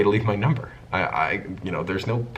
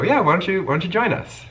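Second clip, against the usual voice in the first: much higher, sing-song camp tease; mock teasing invite; 12 semitones higher, 5 dB louder than usual. Narrator teasing the straight friend to join, high and sing-song. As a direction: lilt it up high to tease him.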